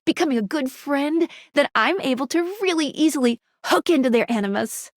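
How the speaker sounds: ordinary talk, nothing marked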